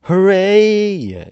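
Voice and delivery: high loud joyful cheer; delighted shriek. A narrator's reading. Rebels cheering the news, high loud shriek. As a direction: shriek with glee. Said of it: "shrieked the rebels".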